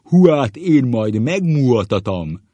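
slow, very loud, high booming threat; hammed-up dreadful schoolmaster; terrible teacher voice. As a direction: thunder it with swollen vowels. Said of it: "A rettenetes sárgaság hangon".

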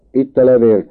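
loud, high cry of discovery; exclaimed. A narrator's reading. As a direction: call it out sharply to the room. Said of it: "kiáltott fel Andrássy".

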